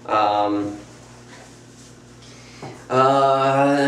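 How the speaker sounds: ordinary talk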